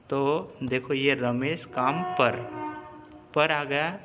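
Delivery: ordinary talk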